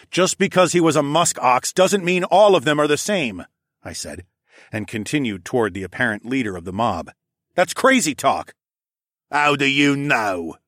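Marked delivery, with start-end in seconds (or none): 0.1-3.4 loud, higher, insistent rebuttal; heated argument. A narrator's reading. Hero defending the musk oxen, heated arguing. Hero argues with mob leader. 7.5-8.5 loud, higher, dismissive retort; heated dismissal. A narrator's reading. Answer in a mob argument, heated dismissal. 9.3-10.6 slow, raised, scornful challenge; sneer. A narrator's reading. Polar bear challenging the hero, sneering. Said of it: "he sneered".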